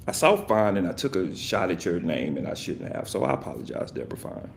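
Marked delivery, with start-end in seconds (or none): 2.4-4.5 softer, trailing mock apology; sheepish mumbled sorry; hushed apology. Real, not acted.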